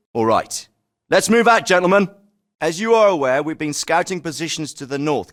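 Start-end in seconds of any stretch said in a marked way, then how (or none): 1.1-2.1 raised, louder commander's call; called-out order. Acted. Officer sending the gentlemen out, called-out order. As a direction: call the men to move, high and loud.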